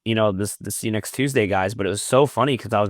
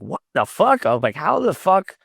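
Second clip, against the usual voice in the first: higher-pitched incredulous outburst; retold bafflement; 7 semitones higher than usual. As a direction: replay your disbelief at the coincidence. Retelling his baffled reaction.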